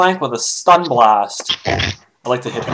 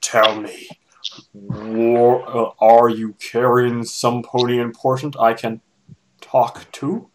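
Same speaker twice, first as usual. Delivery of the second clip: menacing villain; 4 semitones lower, slower than usual. Acted. Nightmare leaning in close.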